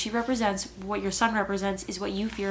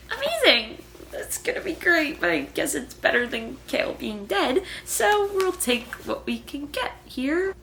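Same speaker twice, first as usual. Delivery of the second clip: sarcastic; 6 semitones higher than usual. Real, not acted. Sarcastic about king learning powers.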